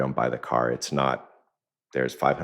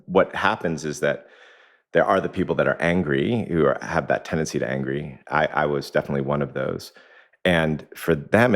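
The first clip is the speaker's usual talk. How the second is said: ordinary talk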